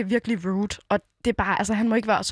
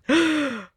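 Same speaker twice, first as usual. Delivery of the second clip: horrified gasp; 5 semitones higher than usual. Retold or imitated. Retelling the heckler's dawning realisation, horrified mock gasp. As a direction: act out his sudden horrified intake of breath. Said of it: "rædslen bliver malet i hans ansigt".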